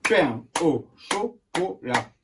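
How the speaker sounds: "Pain au chocolat" slowly in five even pieces; syllable by syllable. Shown as a demonstration. Teacher rechecking the long word, syllable by syllable. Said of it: "on dépasse tout ce qu'on peut imaginer".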